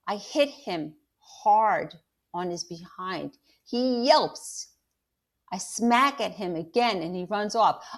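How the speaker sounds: reading a chapter aloud to the class; steady storyteller voice, acting out the quotes; story narration. A narrator's reading. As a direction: read aloud to students. Teacher reads novel to class.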